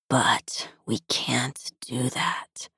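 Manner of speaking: slow, soft, breathy whisper; a low husky whisper to his stepsister. A narrator's reading. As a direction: breathe it, barely voiced and slow. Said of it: "Kurt continued in a husky voice".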